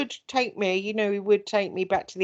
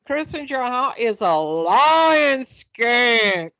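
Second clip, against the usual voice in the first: slow, louder mock Southern drawl; Southern accent; 4 semitones higher, 5 dB louder, much slower than usual. Quoting the Kentucky host's insult in slow Southern accent. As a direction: stretch it out in an American Southern accent. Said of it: "remember when he called you a lion skank".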